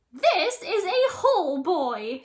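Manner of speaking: high, loud smirking monster. A narrator's reading. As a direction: savor it with a smirk. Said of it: "she smirks".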